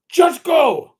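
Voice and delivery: high, loud mock Middle Eastern examiner barking it; imitated Middle Eastern accent. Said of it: "foreign Middle Eastern accent".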